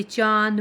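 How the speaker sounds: चांद said slowly with the vowel through nose and mouth; chandrabindu demo. Shown as a demonstration. Teacher slowly demonstrating the chandrabindu sound. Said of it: "इसका उच्चारण नाक और मुंह दोनों से होता है".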